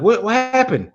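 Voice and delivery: high, alarmed question; surprised. Retold or imitated. Asking the buddy what happened, startled.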